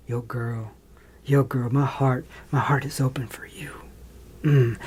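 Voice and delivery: low, soft crooning heartthrob, sinking into a whisper; mock cheesy heartthrob. Mocking the film's romance, low breathy croon. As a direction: purr it like a cheesy movie lover.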